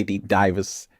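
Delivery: usual speaking voice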